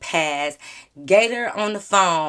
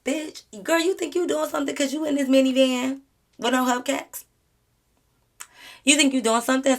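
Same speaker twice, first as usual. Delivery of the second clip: mocking; 6 semitones higher than usual. Mocking Gator to viewers.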